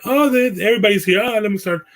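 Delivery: high-pitched mock voice of a wife; high, hurried impression of her reaction; imitating wife. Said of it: "she was like".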